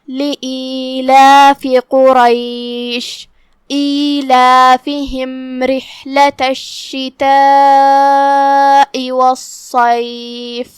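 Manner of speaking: slow melodic tilawah; Arabic Quran recitation. Acted. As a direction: draw out the verse in tajweed. Recites the verse slowly.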